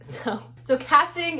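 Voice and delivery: laughing while starting to speak. Real, not acted. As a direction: giggle through the words. Laughing at own confession.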